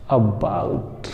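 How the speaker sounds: about low, opening on a short 'uh'; initial schwa demo. Shown as a demonstration. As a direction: model about slowly, falling at the end. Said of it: "जैसे normal पढ़ते हैं अ". (about की शुरुआत छोटे अ से)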